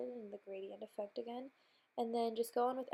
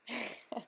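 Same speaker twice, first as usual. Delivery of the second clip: short laugh. Real, not acted. Laughing at own joke.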